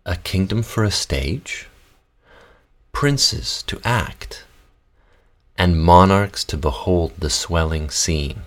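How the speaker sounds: measured theatrical verse reading with long pauses; reading Shakespeare verse. Shown as a demonstration. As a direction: recite the prologue with care between breaths. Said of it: "do your text as you like".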